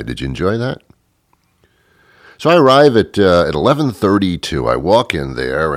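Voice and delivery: normal talking voice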